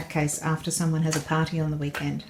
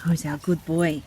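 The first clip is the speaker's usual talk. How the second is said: slow sing-song pet praise; squeaky praising a dog. Real, not acted. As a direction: coo it to the dog.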